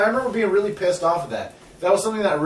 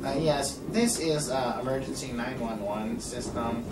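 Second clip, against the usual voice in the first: dispatcher voice; 4 semitones lower, 10 dB quieter than usual. Retold or imitated. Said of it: "and they're like".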